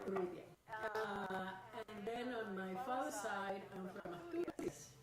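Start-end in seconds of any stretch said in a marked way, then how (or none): 0.1-0.4 Urrutia with a trilled Spanish r; rolled r. Shown as a demonstration. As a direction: roll the r in Urrutia. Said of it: "so you can say Urrutia".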